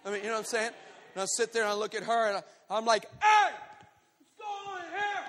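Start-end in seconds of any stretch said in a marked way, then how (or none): 3.2-5.2 high holler that shrinks sharply; acted shout dying away. Man facing a huge woman, shout fading to a squeak. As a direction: start loud, then lose the volume but stay high.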